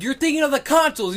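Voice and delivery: high, loud accusing retort; mock bickering. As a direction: imitate a squabbler correcting the other. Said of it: "bickering back and forth".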